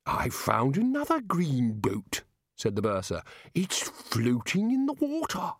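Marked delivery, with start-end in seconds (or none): 0.0-2.2 slow, befuddled character voice; addled bursar. Acted. Muddled bursar interrupting with his find, slow and dreamy. 3.5-5.5 high, slow, dotty voice; addled bursar. Acted. Muddled bursar describing his boat, high and dreamy.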